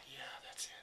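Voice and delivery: soft unvoiced yeah, quietly sure; whispered recognition. Shown as a demonstration. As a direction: breathe it out as you realise it. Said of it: "Things like this".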